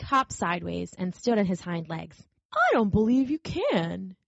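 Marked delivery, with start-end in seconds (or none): 2.5-4.2 slow, lightly voiced skeptical rabbit; a hint of a scoffing wild rabbit; doubting wild rabbit. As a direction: drag out the doubt.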